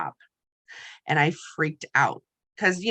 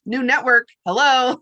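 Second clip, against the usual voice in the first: a touch of louder, higher mock cheer; mock cheerful; 5 semitones higher, 5 dB louder than usual. To her team member taking a job, mock cheerful. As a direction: brightly welcome a new network, half joking. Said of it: "I'm like".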